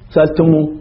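سألتموه cut to a short final "mu"; سألتموه ending on a quick "mu", no long oo; waw dropped. Shown as a demonstration. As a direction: shorten the last vowel of سألتموه to a brief u. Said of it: "فقط نحذف الواو".

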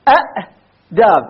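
أأداب said slowly with two glottal stops, a-'daab; original double hamza. Shown as a demonstration. Teacher shows the original form of آداب. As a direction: stretch out أأداب, voicing both hamzas. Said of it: "أصلها أأداب".

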